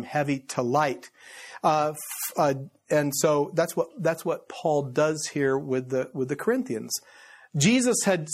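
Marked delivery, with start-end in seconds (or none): none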